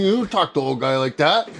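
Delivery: ordinary delivery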